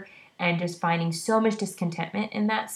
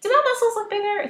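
high-pitched eager question; 5 dB louder, faster than usual. Retold or imitated. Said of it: "I was like".

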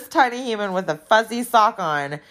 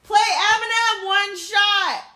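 much higher, slow shout at the speaker; calling out; 14 semitones higher, slower than usual. Real, not acted. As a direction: call Alexa from across the room.

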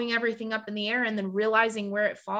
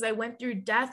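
ordinary delivery

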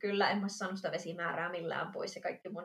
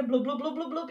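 louder, higher bubbling blubs; imitated pumping noise; 5 semitones higher, 4 dB louder than usual. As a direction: mimic gurgling that keeps on coming. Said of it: "semmoista pumppaavaa ääntä". (pulputtavan äänen matkiminen)

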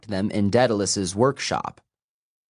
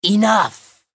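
high, loud commanding shout; monster yell; 12 semitones higher, 6 dB louder than usual. A narrator's reading. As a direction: silence the fight with one cry. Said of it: "the one called Seraphine yelled".

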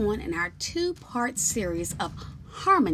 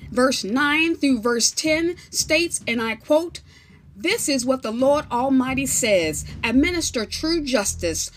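scripture reading; 3 semitones higher, 7 dB louder than usual. Acted. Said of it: "begins to read the following scriptures".

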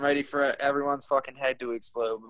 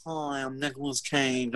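slow, soft nasally Nicolas Cage voice; Nicolas Cage impression; 7 dB quieter than usual. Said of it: "Like, his nasally voice".

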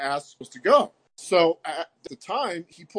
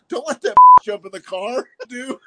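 laughing warning; 8 semitones higher, 14 dB louder than usual. Retold or imitated. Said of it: "he's like".

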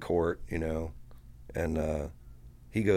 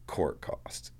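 hushed, much softer muttered words; whispered aside; 6 dB quieter than usual. Retold or imitated. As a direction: breathe it to the other lawyers. DA's muttered verdict retold.

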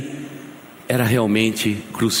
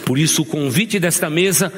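higher, pressing plea to the congregation; fervent preaching; 4 semitones higher than usual. Acted. Pastor urges the church to celebrate, fervent preaching. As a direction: rise and plead, urge them to rejoice.

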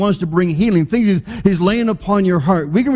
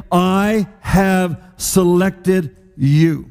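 drawn out very slowly, leaning on each word; stretched, weighty I have selected you; slow emphatic declaration; much slower than usual. Acted. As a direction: let every word land like a promise. Preacher repeats God's promise.

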